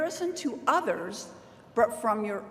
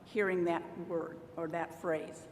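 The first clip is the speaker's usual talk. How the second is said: ordinary talk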